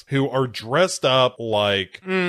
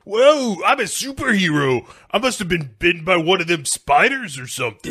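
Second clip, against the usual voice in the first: high, fast, excited character voice; thrilled movie hero; 6 semitones higher, faster than usual. Acted. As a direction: marvel at your sudden superpowers. Playing Milo discovering low gravity.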